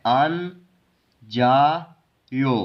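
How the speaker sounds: अन जा यो slowly, a pause between syllables; second consonant starts जा, then यो; double-consonant reading. Shown as a demonstration. Teacher shows the double-consonant rule, slow separated अन जा यो. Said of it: "इसको पढ़ना है".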